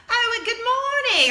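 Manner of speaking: loud, high mock-British greeting; British accent. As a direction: imitate a landscaper's booming British greeting. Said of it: "I actually started speaking British to him".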